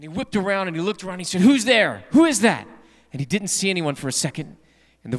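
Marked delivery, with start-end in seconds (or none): 1.4-2.7 loud, high frightened yell into the dark; startled shout. Acted. Thief in a dark house yells at a hidden voice, startled and loud. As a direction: call out, spooked, to an unseen voice.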